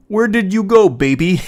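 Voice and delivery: high, loud mock-sweet coo; sing-song fake tenderness; mock lovey-dovey boyfriend. Reading boyfriend's text in mock voice.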